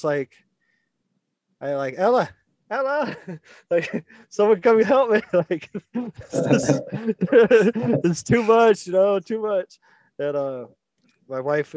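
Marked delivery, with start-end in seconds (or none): 2.0-9.8 high, loud, laughing plea for help; mock desperate call, breaking into laughs; panicked plea. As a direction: cry out to Ella half-laughing, overwhelmed. Retold panic calling to wife.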